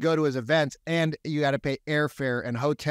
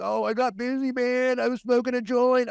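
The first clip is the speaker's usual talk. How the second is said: high, lightly voiced lazy stoner; Darren, stoned excuse; 9 semitones higher than usual. Lazy realtor excusing himself to the agent, a high mock-stoner voice. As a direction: imitate a careless pothead brushing it off.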